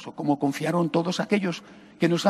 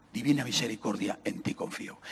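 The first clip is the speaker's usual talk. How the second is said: soft, low, fading into a whisper; hushed devout words; 7 semitones lower, 8 dB quieter than usual. Acted. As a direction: breathe the promise almost silently. Priest voices Christ's words.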